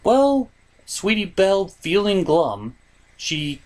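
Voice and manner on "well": normal talking voice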